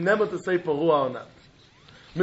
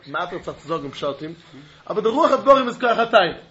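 ordinary delivery

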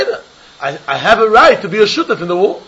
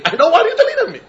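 high indignant refusal; 13 semitones higher, faster than usual. Acted. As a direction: jump way up in pitch, refusing. Acted neighbor quarrel.

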